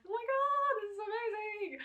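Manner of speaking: high, loud thrilled squeal; excited gush. Retold or imitated. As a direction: gush like it's the best night ever. Excited quote of first party.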